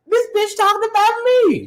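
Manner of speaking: high pitched indignant retelling; mock outrage. Host retelling her reaction on the call, high mock outrage. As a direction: act out shock at being called out. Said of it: "and I was like".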